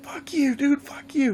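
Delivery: high whiny mock curse; mocking angry player. Killed player cursing at Jason, high mock whine. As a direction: squeak it out like an annoyed gamer.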